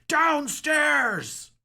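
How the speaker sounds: high, loud order to run; urgent shout. Acted. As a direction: yell the escape over the crash. Tower shattering, fleeing.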